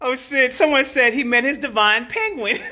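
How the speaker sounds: normal talking voice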